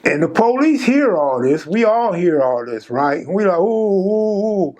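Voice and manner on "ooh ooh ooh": mock ooh. Inmates reacting to the trouble.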